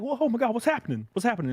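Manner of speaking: high, alarmed mock girlfriend; mock girlfriend panic. Man imitating girlfriend's high panicked reaction. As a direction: squeak it out in fake alarm. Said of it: "It's like".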